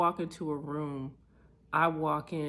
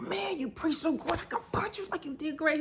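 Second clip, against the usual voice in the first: high, gushing hype for a friend; excited praise; 13 semitones higher, faster than usual. Retold or imitated. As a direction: cheer him on like a proud fan. Praising a friend, quoted herself.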